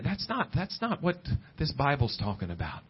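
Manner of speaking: ordinary delivery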